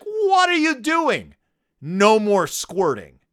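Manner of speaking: loud, drawn-out mock outrage; parental scolding. Mama and Papa to Honey, stern scolding. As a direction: start high and shocked, then lay down the law slowly.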